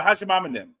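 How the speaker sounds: Cajun "How's your mom and them?", "them" swallowed; Cajun saying. Retold or imitated. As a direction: local Cajun greeting, lightly accented. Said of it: "that's what we say".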